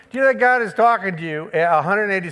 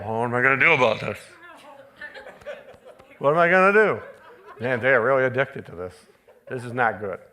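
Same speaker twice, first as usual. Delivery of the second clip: low, fast fretting, half laughing; mock worried God; 8 semitones lower, faster than usual. Preacher mocking the idea of a worried God, lower and laughing. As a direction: play it as a flustered worrier, lightly. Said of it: "He doesn't sit there and go".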